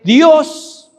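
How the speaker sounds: Dios punched high and loud; stress on Dios. Acted. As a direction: hit the word Dios hard. Preacher punches God's name.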